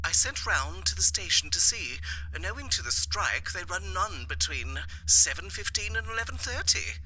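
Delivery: a lady explaining over the line; voicing the wife, light and soft; wife on the phone. Wife on the telephone.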